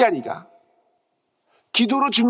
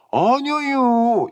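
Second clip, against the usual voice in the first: a very slow, stretched-out "아니요", higher and louder; drawn-out dialect denial; 6 semitones higher, 3 dB louder, much slower than usual. Acted. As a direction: deny it lazily like a country man. 충청도 사람 흉내 대화. (충청도 사람처럼 느릿하게 길게 끄는 부정)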